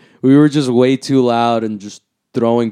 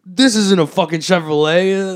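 high-pitched retold exasperation; irritated, pitched-up quote; mock angry cop; 7 semitones higher, 4 dB louder than usual. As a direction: imitate an annoyed cop challenging an excuse. Cop angrily rejects the excuse.

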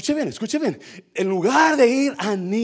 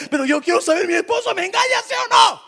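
desperate demand; 13 semitones higher, 6 dB louder, faster than usual. Acted. Wife asking fortune-teller if husband cheats, desperate high demand. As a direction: pitch soaring into a high shout.

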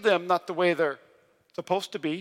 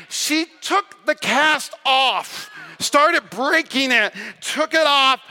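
loud, high, drawn-out climax; slow, emphatic shout of amazement; excited preaching; 5 semitones higher, 4 dB louder, much slower than usual. Real, not acted. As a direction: proclaim each action slowly at full voice. Climax of healing testimony.

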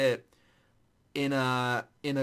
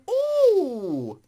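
excited ooh; 9 dB louder than usual. Retold or imitated. Excited reaction to ending.

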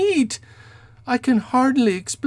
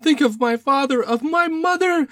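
high, wailing plea for mercy; frantic little man, pitch climbing as he swears; desperate pleading; 5 dB louder than usual. Acted. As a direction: beg as if on your knees. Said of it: "threw himself down suddenly upon the rug".

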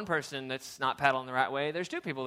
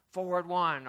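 loud, higher rafting command; guide's call; 5 semitones higher, 5 dB louder than usual. Retold or imitated. Raft guide calling commands to the crew, loud. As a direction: call it out to the paddlers.

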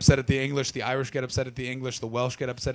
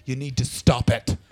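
put-on stern old Englishman. Old Englishman scolding the Middle East, stern and firm. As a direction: tell them off like a disapproving old Brit. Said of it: "with that face and go".

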